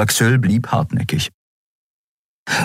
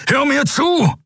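commanding shout. A narrator's reading. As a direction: force him to listen. Kero holding Kai.

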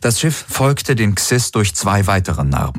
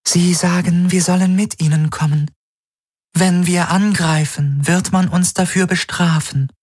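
high, even otherworldly voice; alien helix voice; 11 semitones higher than usual. Acted. Alien helix relays a threat, otherworldly voice.

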